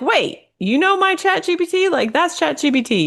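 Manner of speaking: high-pitched mock disbelief; startled recognition. Hearing her chatbot's voice on the internet, startled recognition.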